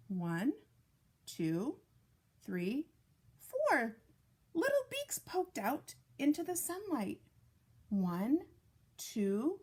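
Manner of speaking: gentle storybook read-aloud; reading to children. Acted. Reads picture book to children.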